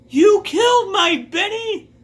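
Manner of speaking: high, loud mock-furious scream; hammed-up outrage, shrieking up high; furious grieving yell. Old lady enraged at shooter.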